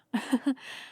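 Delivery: short light chuckle; brief amused laugh. Acted. Amused at dragon's request.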